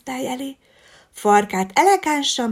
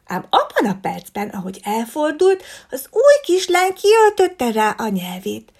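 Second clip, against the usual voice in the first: ordinary delivery, nothing marked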